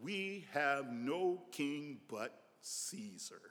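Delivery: slow, hushed, weighty answer; solemn confession. Acted. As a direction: drop the volume and spread the words out. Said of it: "here's their confession".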